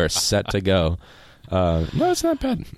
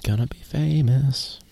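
slow, breathed-out whisper; 7 semitones higher, slower than usual. Pretend. Co-host filling a thinking pause, slow whisper. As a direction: whisper it slowly, unvoiced aside. Teasing aside while co-host stalls.

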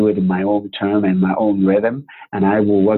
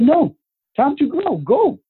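high-pitched, insistent send-off; imitating parents refusing firmly; firm dismissal; slower than usual. Parents sending him off, retold.

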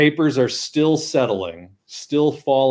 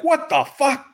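mock outburst; 13 semitones higher than usual. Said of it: "And he's like".

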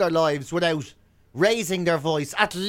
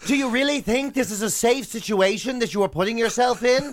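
higher-pitched, put-on American parent voice; weird American accent; 4 semitones higher than usual. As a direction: gently reason with a child, mock American. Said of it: "that sort of weird American accent".

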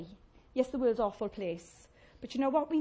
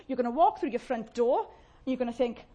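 ordinary talk

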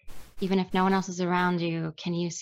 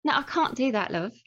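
higher-pitched London accent; amateur British accent, pitched up; 5 semitones higher than usual. Shown as a demonstration. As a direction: put on a playful London accent. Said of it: "Give me a bit of the Brit-, British accent".

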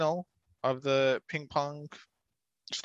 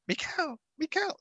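much higher falsetto woman's voice, rising; Miss Clue voice attempt. Shown as a demonstration. Podcaster tries a woman's voice for Miss Clue, in high falsetto. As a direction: squeak out a woman's voice, half joking. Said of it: "So my Miss Clue voice will be".

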